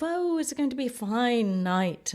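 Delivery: high, hammy royal welcome; mock theatrical greeting from the balcony; hammed-up king and queen. Actor king greets the dinner-show crowd, hammed-up and high. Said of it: "they do sort of".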